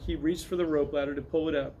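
usual speaking voice

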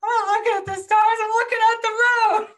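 high, loud furious yell; retold hysterical anger. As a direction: yell it high, frantic behind the wheel. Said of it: "I was so mad".

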